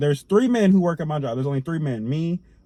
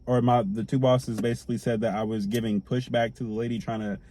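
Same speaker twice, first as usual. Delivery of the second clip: ordinary delivery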